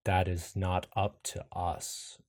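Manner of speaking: slow, ominous, sinking low at the end; measured eerie refusal, dropping deep; deep rumbling voice. Acted. Mysterious voice refuses Iris's plea, deep and rumbling. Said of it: "As the voice says".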